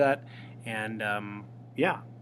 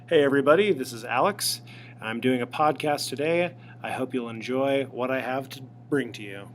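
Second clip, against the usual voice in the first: upbeat podcast intro; 5 dB louder than usual. Shown as a demonstration. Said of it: "Give you an example".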